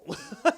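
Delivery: high loud laugh; real laughing fit; burst of laughter. Real, not acted. Laughing at Penguin's betrayal.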